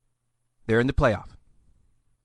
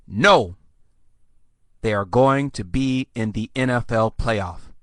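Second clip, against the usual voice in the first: normal talking voice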